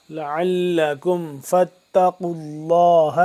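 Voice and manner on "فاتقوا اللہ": فاتقوا with a thick, heavy qaf and اللہ with a full, round lam; heavy qaf, full Allah. Shown as a demonstration. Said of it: "قاف کو موٹا لفظ اللہ پور کر کے".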